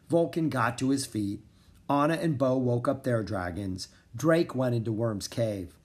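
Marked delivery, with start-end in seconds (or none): none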